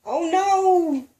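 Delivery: high, loud dismay; little girl spotting the dreaded bus. A narrator's reading. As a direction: blurt it out in alarm. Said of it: "I said kind of loud".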